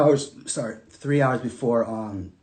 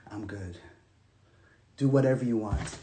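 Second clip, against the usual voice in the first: resigned surrender; 5 semitones lower, 8 dB quieter than usual. Retold or imitated. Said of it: "I was like".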